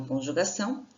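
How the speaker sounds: normal talking voice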